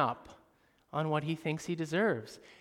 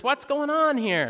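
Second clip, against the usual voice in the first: put-on older brother asking; 10 semitones higher, 9 dB louder than usual. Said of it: "he asks one of the servants".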